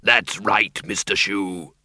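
low, pleased creature voice; happy. A narrator's reading. Bogey cheerfully answers from behind them, low and happy. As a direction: answer gladly in a low character voice. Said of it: "said Schleppel happily".

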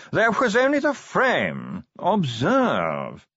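slow, high, languid aristocratic voice; posh yawning drawl. Acted. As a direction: drawl every word like a yawn. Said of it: "modulated yawning".

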